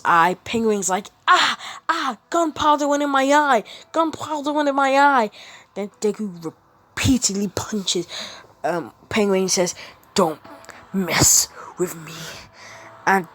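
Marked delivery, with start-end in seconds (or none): 1.3-5.3 higher, mock-pained cries; villain wailing in mock pain, higher; hammed-up pain. Penguin hit in the eye. 10.1-12.1 slow, whispered menace; whispered Batman threat. Acted.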